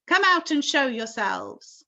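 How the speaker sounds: loud command upward. A narrator's reading. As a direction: order them to come out. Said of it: "shouted the chief of police".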